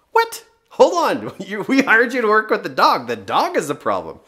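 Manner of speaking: a retold what-did-you-just-say outburst; mock outrage at the trainer; shocked outburst. Retelling shock at the dog trainer.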